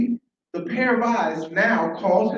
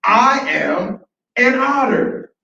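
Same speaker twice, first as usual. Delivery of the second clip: loud, high, slow proclamation; huge triumphant announcement; bursting with energy; 5 semitones higher, 8 dB louder, much slower than usual. Acted. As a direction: shout it proudly, stretching every word. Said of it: "this newfound otter with so much energy".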